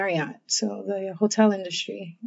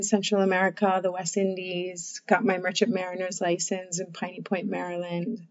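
normal talking voice

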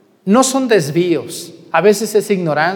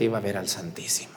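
hushed aside in a whisper; 9 semitones lower, 13 dB quieter, faster than usual. Acted. Priest to congregation, whispering the aside. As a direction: drop to a secret whisper.

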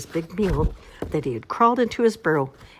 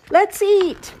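rallying shout; 9 dB louder than usual. A narrator's reading. Groundhog rallies animals to feast.

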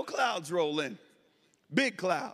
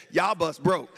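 short, lightly drawled Southern version; quick country drawl; 5 semitones lower, 3 dB louder than usual. Shown as a demonstration. Mechanic means a one-hour fix, short country drawl. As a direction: say it briskly, country style. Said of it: "country people add extra syllables".

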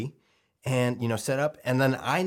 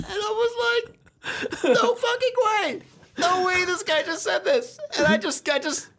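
high, slow words through laughter; laughing; 3 dB louder, slower than usual. Real, not acted. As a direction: talk while still laughing. Laughing while retelling reaction.

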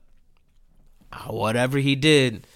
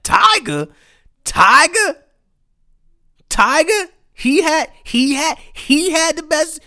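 much higher, louder mocking [name]'s name; mocking [name]; 6 dB louder than usual. [name] on Instagram Live mocking [name], high scornful calls. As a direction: mimic a scornful, incredulous call. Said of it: "he was like".

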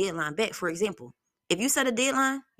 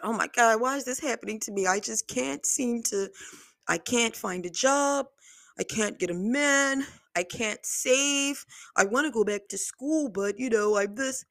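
imitated complaining; 4 semitones higher than usual. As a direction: draw out each mock complaint, pitched up. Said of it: "Stop saying it like that".

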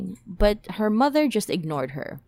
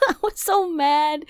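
higher, laughing through the words; laughing half-sobbing repeat; 8 semitones higher than usual. Real, not acted. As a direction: crack up while repeating it.

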